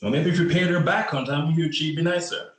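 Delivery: loud, high-pitched jab back; pointed rebuke. Acted. Cop defending mother to man who owes her, pointed rebuke. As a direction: throw the unpaid debt back at him.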